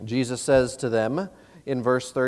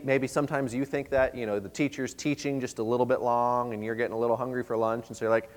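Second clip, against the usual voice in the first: ordinary talk, nothing marked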